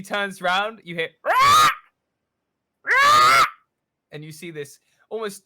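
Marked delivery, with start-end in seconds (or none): 1.2-3.4 shrill squawking caw, very high and loud; crow caw. Acted. Crow landing beside the captain, loud high caw.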